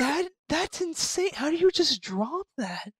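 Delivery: high, slow, loud disbelief; can't believe what he just heard; incredulous. Real, not acted. Friends recapping a horror movie, high incredulous disbelief.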